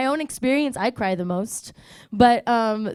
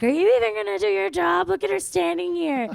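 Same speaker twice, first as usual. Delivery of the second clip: mock yelling; 8 semitones higher than usual. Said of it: "she started yelling at him".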